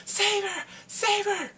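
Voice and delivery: very high mock begging cry; desperate plea. He rushes her to hospital, high desperate plea. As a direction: beg urgently in a high cry.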